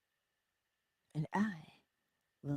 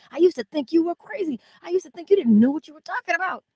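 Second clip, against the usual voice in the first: much higher and louder, rushing the second line; excited friend quote; 11 semitones higher, 11 dB louder, faster than usual. Retold or imitated. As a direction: act out the friend's breathless confession. Friend's message acted out excitedly.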